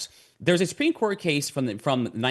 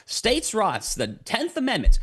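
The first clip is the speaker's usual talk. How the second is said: pitched-up mock Southern drawl on states' rights; thick Southern accent; 9 semitones higher, slower than usual. Recasting the Minnesota attorney general as a Southerner, mock Southern accent. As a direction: lampoon a Southern politician with a higher-pitched Southern accent. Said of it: "you put a thick Southern accent".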